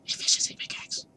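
whispered in awe; awed whisper. Real, not acted. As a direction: breathe it out, spellbound. Gloating over loot.

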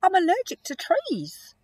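high, surprised sneeze-up polar bear. Acted. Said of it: "said with surprise".

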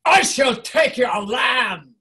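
slow, loud roar from the gut; lowest character voice. Shown as a demonstration. Teacher demonstrating a villain claiming land, booming gut voice. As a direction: bellow a drawn-out conqueror's threat. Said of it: "That's the lowest one".

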